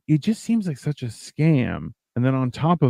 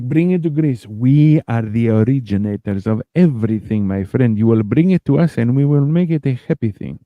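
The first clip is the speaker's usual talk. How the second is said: hammed-up Greek accent. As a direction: play Greece pitching itself in a Greek accent. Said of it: "Greece has been dying to do that. They're like".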